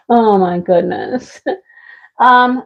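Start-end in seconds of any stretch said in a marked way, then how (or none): none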